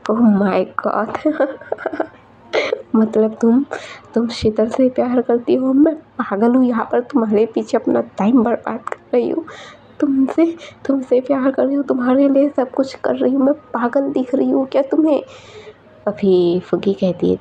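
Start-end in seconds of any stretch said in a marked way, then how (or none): 0.1-3.0 bitter laugh. Acted. Bitter disbelief at confession. 3.0-15.2 higher, agitated, hurt and ranting; jealous outburst. Acted. Jealous friend confronting Fuggi.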